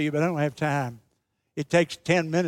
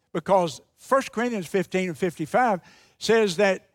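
ordinary delivery

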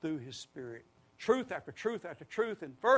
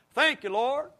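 prayerful shout; 8 semitones higher, 8 dB louder than usual. Shown as a demonstration. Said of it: "Let's all say this again".

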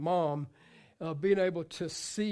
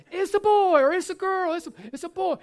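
higher, delighted announcement; excited parents; 10 semitones higher, 6 dB louder than usual. Retold or imitated. Parents announcing newborn, mock excited. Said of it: "And so".

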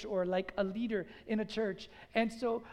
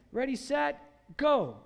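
louder, higher countdown with a punched last word; starting call; 4 semitones higher, 6 dB louder than usual. Acted. Professor sending seminary students off to preach, calling out loudly. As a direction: send them off like a race starter.

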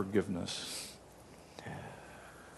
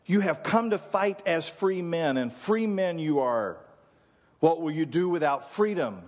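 raised, ringing battle cry to the troops; rallying battle speech; 6 semitones higher than usual. Acted. Preacher voicing William Wallace rousing his army, raised and ringing. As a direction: rouse an army to fight for freedom. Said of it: "He says".

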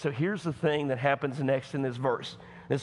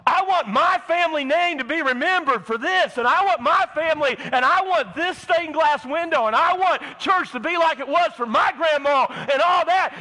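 much higher, loud, demanding whine; mock churchgoer; 11 semitones higher, 8 dB louder than usual. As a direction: whine like a spoiled member. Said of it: "Oh, yeah, I'm going there".

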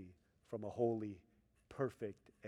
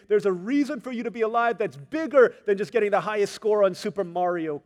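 high, loud, driving sermon voice; preaching at full force; 8 semitones higher, 14 dB louder than usual. Acted. Pastor urging the young congregation toward purposeful friends, loud and high preaching. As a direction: preach it out big to the back row.

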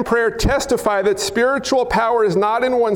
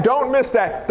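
usual speaking voice

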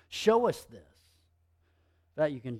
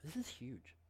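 ordinary talk, nothing marked

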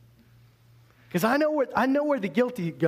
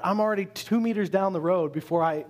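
usual speaking voice

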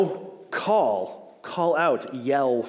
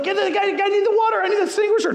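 much higher, louder frantic calls; panicked shop workers; 8 dB louder, faster than usual. Retold or imitated. Mechanics shouting for the extinguisher during a fire, high frantic yell. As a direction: yell for water as the fire spreads. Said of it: "so they're like".

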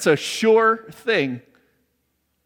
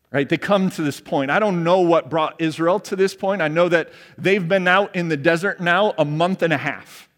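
normal talking voice

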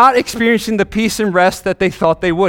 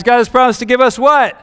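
higher, louder, pushing for the answer; emphatic prompting question; 5 semitones higher, 3 dB louder than usual. Shown as a demonstration. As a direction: prod the kids for the answer. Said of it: "this is an easy test, guys".

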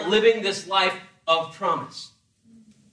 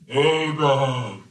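low, drawn-out divine call; movie God voice; 7 semitones lower, much slower than usual. Retold or imitated. Movie God summons Abraham, low and slow. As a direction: boom it out like God in a film. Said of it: "he sounds like this".

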